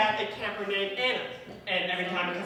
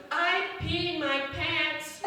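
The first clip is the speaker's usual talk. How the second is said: put-on little girl voice; 7 semitones higher, much slower than usual. Kid confessing, camp story.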